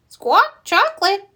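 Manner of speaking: high squawking parrot voice; loud squawk, then high bird mimicry; parrot repeating. A narrator's reading. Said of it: "Polly repeats".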